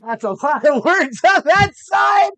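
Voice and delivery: loud, high laughing jab; laughing outburst. Real, not acted. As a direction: burst out teasing over the long answer.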